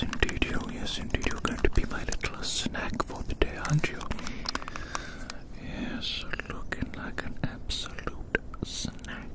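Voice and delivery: ordinary talk, nothing marked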